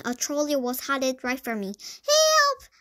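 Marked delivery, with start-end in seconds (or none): 2.1-2.7 very high, loud squeal for help; squeaked at full volume. A narrator's reading. Mouse stuck before an oncoming trolley, squealing for help. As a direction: squeal out in panic. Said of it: "squeaked at the top of my lungs".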